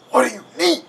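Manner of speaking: high, strained rough character voice. A narrator's reading. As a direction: rasp it out like a rough guard. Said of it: "in a rough voice".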